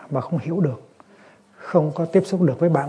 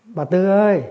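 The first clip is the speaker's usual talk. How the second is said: calling out; 5 semitones higher, 5 dB louder than usual. Retold or imitated. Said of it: "ông kêu".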